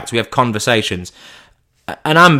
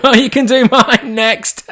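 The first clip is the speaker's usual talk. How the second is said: high, drawn-out cheesy joke voice; 12 semitones higher, 4 dB louder, slower than usual. Acted. Cheesy neighbour jokes about the lawn, hammed-up. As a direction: ham up a corny gag. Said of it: "if you're a real cheesy bastard".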